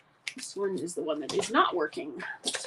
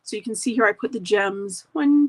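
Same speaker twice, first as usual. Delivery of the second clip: normal talking voice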